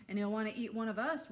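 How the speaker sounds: joking. Acted. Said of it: "Ralphie joked".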